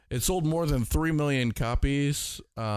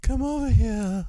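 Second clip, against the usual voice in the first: put-on Spanish accent; 12 semitones higher, 4 dB louder than usual. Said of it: "that thick Spanish accent".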